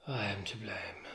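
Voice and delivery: low, whispered admission; faint. Acted. Troubled husband answering his wife's summons, faint low whisper. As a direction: say it faintly, almost all breath. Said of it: "Why do you speak so faintly?".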